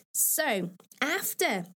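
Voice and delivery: usual speaking voice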